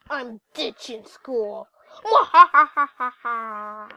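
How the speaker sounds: slow evil villain laugh; slow theatrical villain menace. Acted. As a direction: cackle like a cartoon villain. Kid ditching school, evil laugh.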